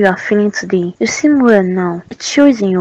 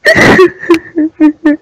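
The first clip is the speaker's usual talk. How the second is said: sob; 6 semitones higher, 4 dB louder than usual. Acted. Daughter answering her father's call.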